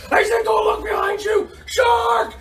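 very high, alarmed yell; mock panic shout. Coach springing pretend shark on kids, high panicked shout. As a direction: shriek the warning in pretend terror.

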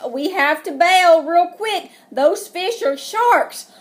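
loud, high, slow urgent warning; a mock-nervous father; nervous dad. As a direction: sound worried about the sharks. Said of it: "Dad looks nervous".

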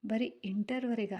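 plain reading voice

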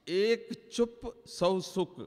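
slow quoted maxim. Retold or imitated. Quoting Baba's maxim.

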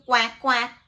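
loud, short quacks; punchy double quack; duck quacking. Acted. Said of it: "says the duck".

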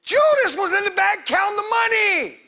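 high, loud preacher's cry; preaching shout. Real, not acted. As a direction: shout it out to the congregation.

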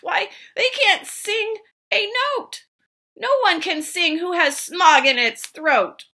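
high complaining creature voice; querulous Lorax. Acted. Lorax complains to the Onceler about smog, a querulous croak. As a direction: grumble it up high, fussing. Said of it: "he cried with a querulous croak".

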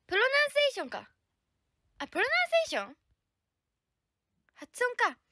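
loud, high burst as it clicks; high-pitched, excited discovery; sudden realization. Real, not acted. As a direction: exclaim it as the word finally makes sense. Finally understands the difficult word.

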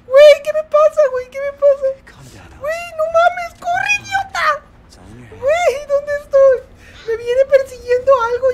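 high, loud comic screaming; hammed-up panic. Streamer fleeing the witch in the game, high comic screaming. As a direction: shriek and whimper as if the witch is on you.

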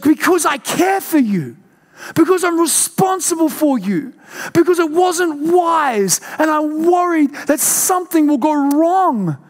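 high, slowing, near crying; tearful mum. Retold or imitated. Mum explaining to her son why she is angry, tearful. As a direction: plead through tears of worry. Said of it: "She's like".

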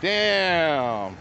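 ordinary talk, nothing marked

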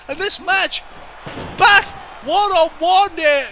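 near-shrieking hype call; excited shouting. Real, not acted. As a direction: scream the surprise save to the crowd. Surprise save during match.

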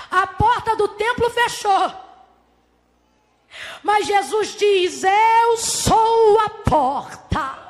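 high, loud, stretched-out preacher's cry; triumphant drawn-out shout; climactic proclamation. Acted. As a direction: draw out each word as a huge declaration. Preacher climaxing on Jesus's declaration.

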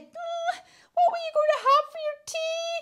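very high, quick whimpering squeak; frightened witch. Acted. Witch asks hungry dragon about his tea, squeaky fear. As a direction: squeak it like a scared little witch.

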